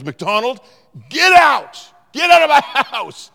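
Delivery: furious shout. Retold or imitated. Youth pastor kicking the narrator out, imitated furious yell. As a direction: explode at the prankster. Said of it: "Like he lost his mind".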